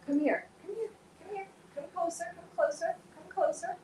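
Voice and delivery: high, soft coaxing to a pet; coaxing pet talk. Real, not acted. Calling her tangled cat closer in high soft baby talk.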